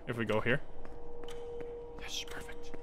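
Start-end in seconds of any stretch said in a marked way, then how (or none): 2.0-2.7 whispered little yes; hushed satisfaction. Real, not acted. Gamer pulling off a timed puzzle, whispered satisfaction. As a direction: whisper it to yourself, pleased.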